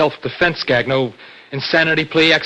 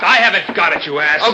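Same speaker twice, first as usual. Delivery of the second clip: loud, raised, exasperated retort; angry denial; 4 semitones higher, 5 dB louder than usual. Acted. Accused brother denying the theft, heated. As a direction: fling the accusation back.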